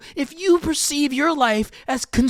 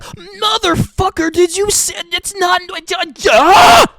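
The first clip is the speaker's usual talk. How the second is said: furious outburst; 5 semitones higher, 6 dB louder than usual. Retold or imitated. To friends who walked away, building into a shout. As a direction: snap at them, then yell out loud. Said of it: "And I'm like".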